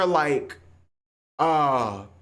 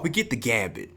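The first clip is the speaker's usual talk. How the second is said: ordinary talk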